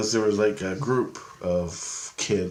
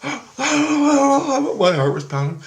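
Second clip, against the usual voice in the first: breathless fright; 6 dB louder, much slower than usual. Retold or imitated. Gasping, scared.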